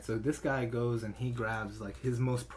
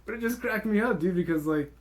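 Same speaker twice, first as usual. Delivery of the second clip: laughing; 8 semitones higher, 7 dB louder than usual. Real, not acted. Laughing retelling, raised voice.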